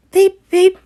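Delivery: very high, loud train whistle beeps; whistle imitation. A narrator's reading. Storyteller making Edward's whistle very high. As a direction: squeal it like a steam whistle. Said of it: "he whistled".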